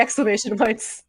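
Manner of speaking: ordinary delivery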